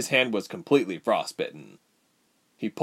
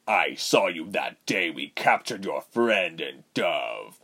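slow, lower, threatening villain voice; menacing villain; 3 semitones lower than usual. Acted. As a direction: draw out the threat, low and controlled. Voices villain Reuben in story.

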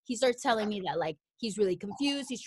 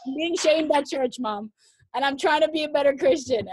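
higher, louder fretting whine; distressed self; 6 semitones higher, 7 dB louder than usual. Retold or imitated. She complains to her mom about church shame, high and whiny. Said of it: "I'm like".

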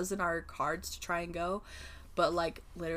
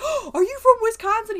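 much higher and louder, delighted squeal; excited; 12 dB louder than usual. Retold or imitated. Woman in a Green Bay mask thrilled to meet a Wisconsin fan, imitated with an excited squeal. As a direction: mimic a thrilled stranger's happy surprise. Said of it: "her face was so happy".

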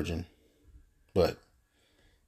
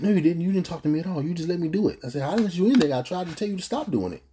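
retold argument; 10 semitones higher than usual.

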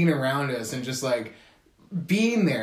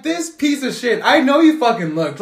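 higher and louder, a heated accusing burst; mock angry rant; 8 semitones higher, 7 dB louder than usual. Speaker fuming at the bragging classmate, a mock angry rant. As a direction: call out the liar.

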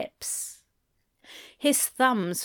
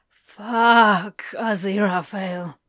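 aroused groan; 5 dB louder, slower than usual. Acted.

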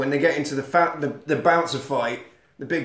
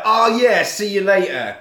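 eager bros; 5 semitones higher, 4 dB louder than usual. Retold or imitated. Bros eagerly accept party invitation.

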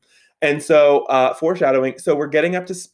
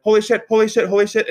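high, louder rapid-repeat alarm; retold panic; 9 semitones higher, 4 dB louder, faster than usual. Driver seeing the grill lift in the mirror, panicked. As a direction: blurt it over and over in alarm.

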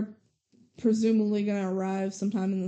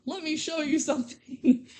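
higher-pitched, hammed-up grandpa line; mock movie grandpa; 6 semitones higher than usual. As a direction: send up the trope of a grandpa with a secret to share. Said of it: "the same trope of Grandpa going".

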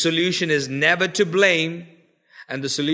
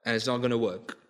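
soft, lower recap of the points; quiet summing up; 7 semitones lower, 8 dB quieter than usual. Acted. Preacher recapping Elisha's lessons for the church, quietly. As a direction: wind it down gently.

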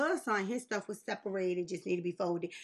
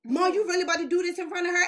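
exasperated son; 8 semitones higher, 6 dB louder, faster than usual. Retold or imitated. Son protests to mom.

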